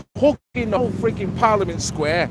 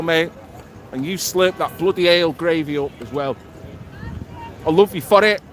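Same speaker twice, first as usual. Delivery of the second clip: normal talking voice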